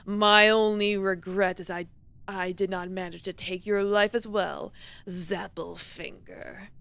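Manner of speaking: slow, defiant last words, sinking in volume; defiant condemned demon. Acted. Horn demon gives last words to the executioner, low and defiant.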